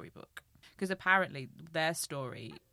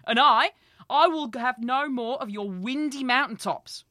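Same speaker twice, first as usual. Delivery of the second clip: spirited retort; 9 semitones higher, 12 dB louder than usual. Acted. Skadi answering her husband, loud defiant retort. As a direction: fire back, much louder and higher. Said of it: "Skadi answered him with spirit".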